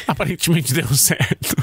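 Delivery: normal talking voice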